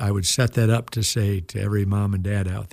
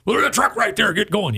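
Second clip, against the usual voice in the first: pitched much higher, impatient retold scolding; imitated angry father; 10 semitones higher than usual. Angry father telling sons to take truck, imitated scolding. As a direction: replay dad's impatient comeback, high and sharp.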